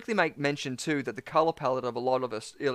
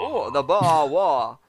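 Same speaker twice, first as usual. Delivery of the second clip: slow, drawled Boston "the Boer War", "pah waw"; "the Boer War" dropping the r, Boston-style; Boston accent; 6 dB louder, much slower than usual. Pretend. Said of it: "That's a very good Boston accent".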